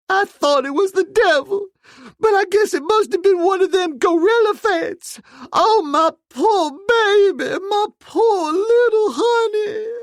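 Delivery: very high wailing, sob in the voice; terrified sobbing wail. A narrator's reading. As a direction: cry it out in horror. Maid's voice held throughout.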